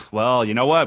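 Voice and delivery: usual speaking voice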